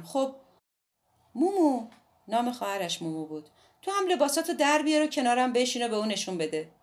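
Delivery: put-on a defiant girl explaining herself; Midori's voice. A narrator's reading.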